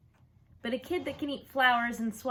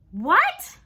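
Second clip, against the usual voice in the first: loud, higher cry of disbelief; disbelieving what; 10 semitones higher, 14 dB louder than usual. Acted. Mother hearing Fudge is on TV, stunned disbelief. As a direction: gasp out the question in shock. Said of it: "said it like she couldn't believe it".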